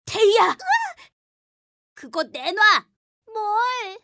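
loud, angry threats; angry demand. Acted. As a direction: snap at her to hand it over. Boy demands book, threatens.

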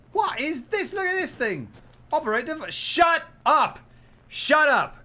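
loud, high exasperated yelling; frustrated shouting. Real, not acted. Gamer yelling shut up at an annoying game operator.

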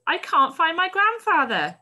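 voiced boy crying out in panic; desperate boy. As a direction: beg like a lost child. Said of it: "cried a young boy desperately".

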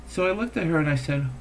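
ordinary talk

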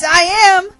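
loud, high comeback; dog's gloating, forceful retort; insistent shout. Acted. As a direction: shout it back to win the argument. Dog insists on the threat.

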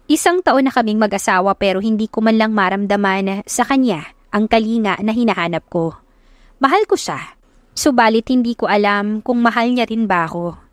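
plain reading voice

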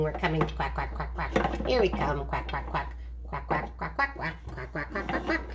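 low, soft quacking puppet voice; quacking ducks. Pretend. Duck sisters on their way over, soft low quacking. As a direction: quack softly and low in little bursts.

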